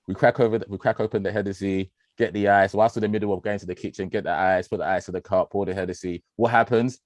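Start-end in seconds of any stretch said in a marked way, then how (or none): none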